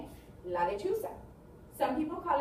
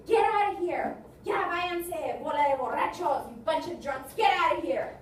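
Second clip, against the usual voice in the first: loud, raised-pitch order to leave; shouting drunks out; 3 semitones higher, 6 dB louder than usual. Acted. Bar staff to drunks at closing time, shouting. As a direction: throw them out at full volume.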